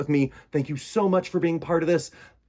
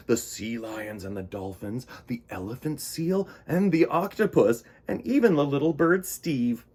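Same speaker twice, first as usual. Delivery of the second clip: gentle read-aloud storytelling; storybook narration. A narrator's reading. Reading a children's story.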